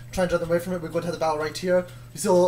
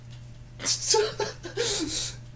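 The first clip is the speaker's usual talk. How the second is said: high laughing fit; 14 semitones higher, 6 dB quieter than usual. Real, not acted. Breaks character laughing.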